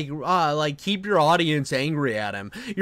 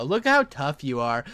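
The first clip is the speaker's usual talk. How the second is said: usual speaking voice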